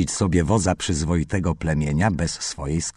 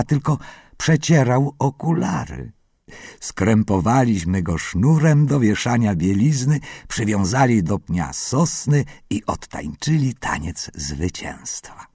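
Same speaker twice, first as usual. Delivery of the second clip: plain reading voice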